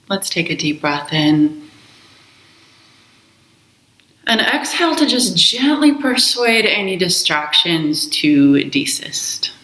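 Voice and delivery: slow, calm meditation voice with long pauses; guided meditation. Acted. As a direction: guide them gently into the breath, unhurried. Leads a guided breath meditation.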